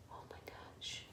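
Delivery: barely breathed oh my gosh; whispered gasp. Real, not acted. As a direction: whisper it in shock. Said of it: "whispered reader aside".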